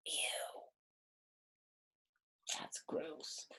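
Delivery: soft whispered ew; hushed, playful disgust. Real, not acted. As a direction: whisper it, grossed out. Disgust at worm picture.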